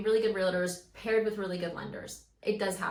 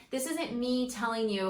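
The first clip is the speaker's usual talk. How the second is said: ordinary talk, nothing marked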